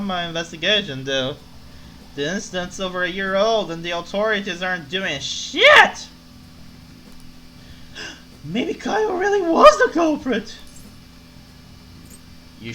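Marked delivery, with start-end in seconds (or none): 2.2-10.6 loud tipsy outburst; drunkenly shocked realization; drunk voice. Acted. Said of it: "to make the drunk voice".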